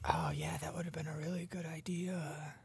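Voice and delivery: whispered aside; half-voiced retold whisper. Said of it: "I was like".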